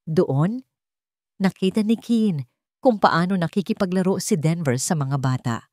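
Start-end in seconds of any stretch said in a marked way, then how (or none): none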